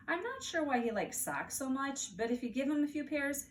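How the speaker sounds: ordinary delivery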